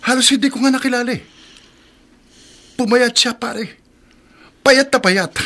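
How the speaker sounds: breathless with the news; excited gossip. Acted. Excitedly tells shocking news. (sabik na pagkukuwento, mataas ang boses)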